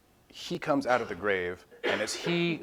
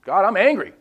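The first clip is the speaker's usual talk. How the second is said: higher, louder blurted confession of anger; angry prayer; 9 semitones higher, 11 dB louder than usual. Retold or imitated. Someone telling God he is upset, loud and angry. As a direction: blurt out honestly that you're mad. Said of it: "Told him when he was upset".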